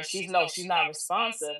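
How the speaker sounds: normal talking voice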